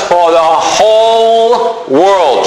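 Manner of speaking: WHOLE drawn out long and slow; stress on WHOLE. Real, not acted. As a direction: stretch "whole" to underline its scope. Emphasises scope of the verse.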